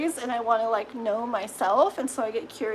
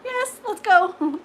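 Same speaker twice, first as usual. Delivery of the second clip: eager squeal of yes, much higher; excited yes; 9 semitones higher, 4 dB louder, slower than usual. Retold or imitated. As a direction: jump at the invitation, thrilled. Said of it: "I'm like".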